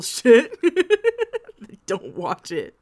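laughing through the warning. Real, not acted. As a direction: giggle out the line. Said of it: "laughing through warning".

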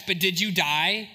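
low, slow deadpan question; mock dismissive comeback, drawn out; imitated reckless driver. Driver answers a tense passenger, low and dismissive. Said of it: "they're like".

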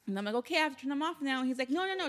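ordinary talk, nothing marked

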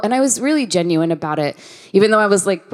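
ordinary talk, nothing marked

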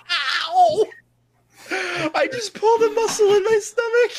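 high, tearful voice after hysterics; laughing in pain. Real, not acted. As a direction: squeak it out, sore from laughing. Hurt from laughing so hard.

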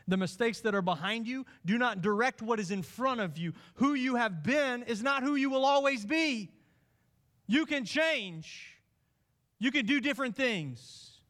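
rising preaching. Acted. Preacher telling the congregation they can change, rising fervour. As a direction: lift the congregation toward hope.

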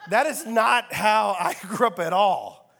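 slow, laughing through the words; real laugh mid-sentence; amused, chuckling disbelief. Real, not acted.

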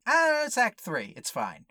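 high shrug then drop; dismissive mock. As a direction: brush it off mockingly. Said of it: "It just goes".

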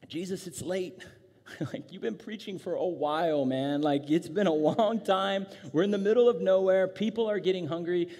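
acted whiny protest, growing insistent; exasperated disciples. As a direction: nag like a worn-out follower who wants to stop. Acts disciples complaining to Jesus.

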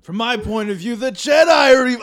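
high, loud hammed-up movie line; overacted serious line. Shown as a demonstration. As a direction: belt it out like a bad drama. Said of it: "I'm gonna try and act and say that line as serious as I can".

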